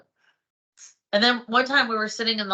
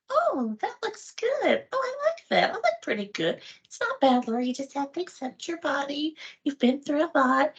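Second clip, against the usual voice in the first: pleased self-talk; 6 semitones higher than usual. Retold or imitated. Woman flattering herself at the mirror, high and approving. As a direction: talk yourself up in a high little voice.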